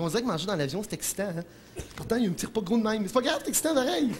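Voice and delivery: normal talking voice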